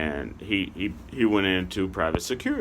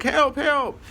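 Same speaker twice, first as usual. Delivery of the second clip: very high falsetto imitation; mock cry for help. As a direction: squeal it like a woman calling out. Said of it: "she's like".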